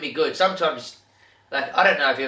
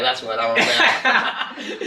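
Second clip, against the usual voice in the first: higher, slow, hammed-up swagger, laughing; tough guy boast; 12 semitones higher, 4 dB louder, much slower than usual. Rigby to the manager after a big tackle, mock swagger. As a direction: drawl it out like a hard man.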